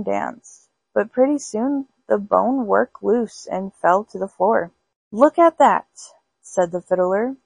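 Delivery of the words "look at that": loud, high cry of alarm; alarmed shout. Acted. Fiddler shouting at the dancing bones, alarmed. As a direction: shout it out, startled.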